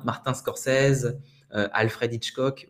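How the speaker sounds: "Martin Scorsese" as "mar-tin scor-sèze", "Hitchcock" without H; slow French reading of "Martin Scorsese" and "Alfred Hitchcock"; French pronunciation. Shown as a demonstration. Teacher showing a good French pronunciation of celebrity names, Frenchified. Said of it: "une bonne prononciation française".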